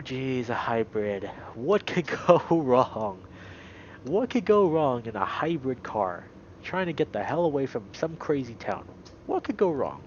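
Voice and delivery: ordinary delivery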